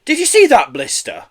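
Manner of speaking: high, loud, amazed question; can't believe what just went by; astonished. A narrator's reading. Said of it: "exclaimed the second man".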